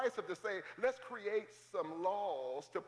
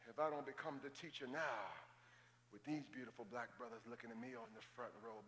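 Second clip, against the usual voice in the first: much softer, lower teacher's tone; soft, lower explaining voice; quiet teaching voice; 8 semitones lower, 10 dB quieter than usual. Real, not acted. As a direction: lean in and teach softly. Drops into teaching mode.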